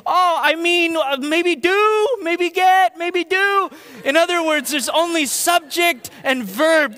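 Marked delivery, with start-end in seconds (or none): 0.0-3.7 high, loud mock voice; much higher, louder impression of a coworker; imitating Riz. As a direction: do Riz's half-sentences. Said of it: "Instead, he might say".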